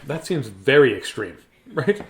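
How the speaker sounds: plain reading voice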